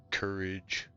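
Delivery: ordinary delivery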